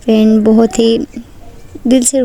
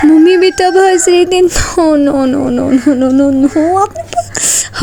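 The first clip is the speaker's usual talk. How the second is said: whiny protest; 4 semitones higher, slower than usual. A narrator's reading. Neha whining embarrassed.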